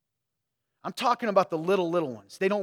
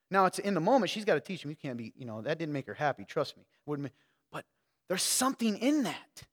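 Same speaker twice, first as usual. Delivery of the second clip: usual speaking voice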